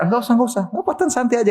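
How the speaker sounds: high, easygoing no-need; kind reassurance. Retold or imitated. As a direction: wave off the fare kindly. Driver refuses payment kindly.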